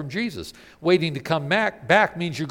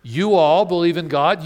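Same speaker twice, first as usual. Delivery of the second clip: normal talking voice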